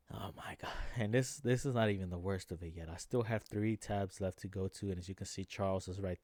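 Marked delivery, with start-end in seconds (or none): 0.1-0.7 exasperated whisper. Real, not acted. Exasperated about the UFC.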